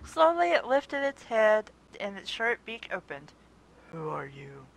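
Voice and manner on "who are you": low, quiet raspy creature; raspy griffin voice. Acted. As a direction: rasp it low and weary. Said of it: "the griffin asked in a raspy voice".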